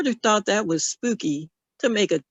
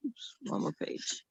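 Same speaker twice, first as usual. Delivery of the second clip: soft unvoiced whisper to herself; whispered aside; 3 semitones higher, 14 dB quieter than usual. Real, not acted. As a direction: breathe it out as a quick aside. Quick aside between pages.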